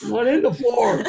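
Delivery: high-pitched, laughing echo. Real, not acted. Host joining the guest's joke about the floor, laughing.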